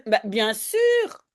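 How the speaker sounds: slow, drawn-out ironic bien sûr. Acted. Teacher voices the mother's ironic reply, slow and knowing. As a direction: let the irony hang on the words. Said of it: "c'est ironique".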